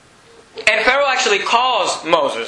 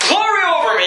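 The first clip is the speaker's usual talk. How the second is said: high, bright exclamation of triumph; excited Moses; 8 semitones higher than usual. Acted. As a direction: burst out in delight. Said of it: "Moses is excited".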